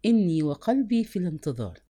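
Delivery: ordinary delivery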